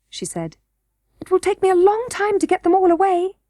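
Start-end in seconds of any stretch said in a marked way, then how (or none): 1.2-3.4 put-on high little-girl voice; young princess Irene, daunted. A narrator's reading. Princess tells Curdie the stones will take ages, high-voiced. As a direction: voice a princess facing a big job.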